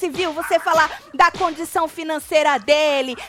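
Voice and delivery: loud, high re-enacted outrage; mock-yell it like a scandalised scolding; imitated angry shouting. Morango berates Chay over talk of someone's finances, mock shouting. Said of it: "gritando com o Chay".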